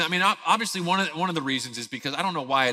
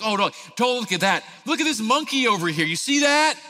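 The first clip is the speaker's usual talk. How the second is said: higher, fast, bright-eyed coaxing; distraction voice; 7 semitones higher, faster than usual. Retold or imitated. Dad distracting his crying son at the zoo, eager coaxing. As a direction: pull the toddler's attention to the monkey. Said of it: "I'm a master of distraction".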